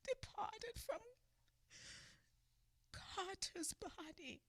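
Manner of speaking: tearful, hushed grief; crying. Real, not acted. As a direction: let the words break as you weep. Preacher grieving the lynching.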